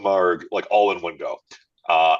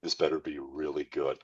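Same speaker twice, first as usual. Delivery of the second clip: low, soft in-character warning; 4 semitones lower, 10 dB quieter than usual. Acted. Drinker looking back at the bartender, low quiet warning. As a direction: say it quietly, like a threat.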